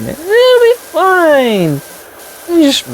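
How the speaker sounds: very high, slow sing-song "It'll be fine"; sing-song reassurance. Acted. Player mocking own chimney plan, very high slow sing-song. Repeats reassurance to self, sarcastic.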